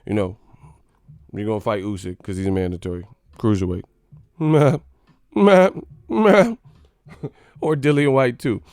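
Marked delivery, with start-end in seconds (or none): 4.4-6.6 rising, louder mocking meh; dismissive meh. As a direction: scoff it off with a meh.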